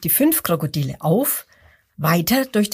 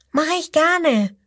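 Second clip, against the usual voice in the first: high, eager little voice; happily taking the challenge; little crocodile agreeing; 9 semitones higher than usual. Acted. Little crocodile accepts the dare, high eager voice. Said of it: "sagt das kleine Krokodil".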